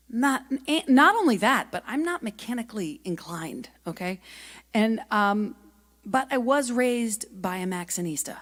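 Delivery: normal talking voice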